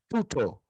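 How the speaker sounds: "Tuto" as TOO-to, no rough h; smooth breathing. Shown as a demonstration. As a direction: say "Tuto" with stress on TU. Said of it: "Aí é branda".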